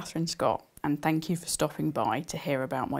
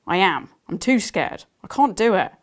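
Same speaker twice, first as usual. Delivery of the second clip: put-on louder, higher frightened girl; scared; 4 semitones higher, 8 dB louder than usual. Beth telling Destiny she is too scared, loud and afraid. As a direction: panic rising, a bit loud. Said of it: "I think you're just scared".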